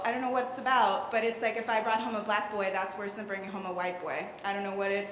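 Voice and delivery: ordinary delivery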